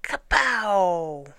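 loud, drawn-out kapow; explosive comic-book slap; slap effect. A narrator's reading. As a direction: burst out with a falling whack sound. Sound of the mosquito being slapped.

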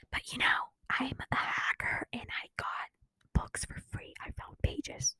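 whispered secret aside; conspiratorial whisper. Acted. Teen vlogger confessing to viewers she pirates books, whispering.

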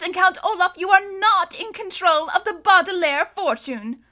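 high, emphatic ruling building in volume; deliberating judge. A narrator's reading. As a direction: proclaim the verdict with mounting triumph. Judge voiced delivering her ruling.

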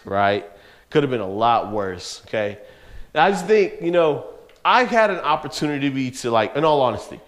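usual speaking voice

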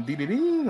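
high beeping notification sound; phone notification beeps. Retold or imitated. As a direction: beep like a phone alert.

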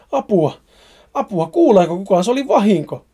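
sinking man shouting for rescue; screaming for help. Acted. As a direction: cry out as you are pulled under. Dragged into mud screaming.

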